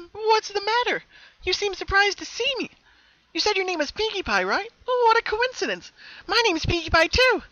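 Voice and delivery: very high, loud, perky; happy Pinkie greeting her sad double, bubbly; bubbly twin Pinkie. Acted. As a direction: chirp it with cheerful mischief. Happy twin teases shocked Pinkie.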